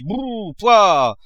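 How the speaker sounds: loud scornful pouah; disgusted sputter. A narrator's reading. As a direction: spit out the sound in disgust. Miser's disgusted exclamation.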